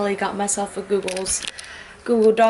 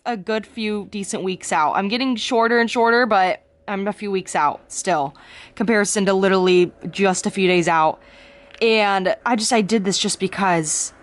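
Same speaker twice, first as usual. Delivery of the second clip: usual speaking voice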